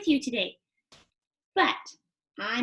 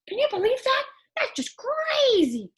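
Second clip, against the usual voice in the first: higher, wide-eyed wonder; can't believe it; amazed puppet; 9 semitones higher than usual. Acted. Puppet marveling to kids about falling rain.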